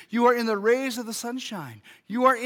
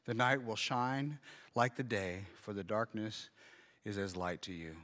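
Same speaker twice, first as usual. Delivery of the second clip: scripture reading; 7 semitones lower, 6 dB quieter than usual. Acted. Pastor reading Psalm 139 to the congregation, soft and reverent. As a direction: read the psalm gently, letting it settle.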